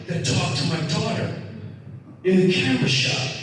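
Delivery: imitating a woman choking up; retold tearful plea. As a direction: play a mother starting to cry. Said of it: "she starts crying".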